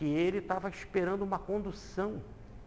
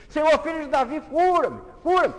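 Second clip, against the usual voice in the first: building, ever higher and louder cry; desperate shout; 10 semitones higher, 10 dB louder than usual. Acted. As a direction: scream louder each time. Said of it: "O cego começou a gritar".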